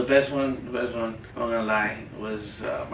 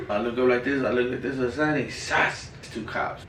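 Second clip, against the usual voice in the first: ordinary talk, nothing marked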